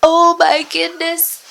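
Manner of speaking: high, loud, drawn-out shock; startled at still recording; startled oh my goodness. Real, not acted. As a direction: gasp it out in real dismay.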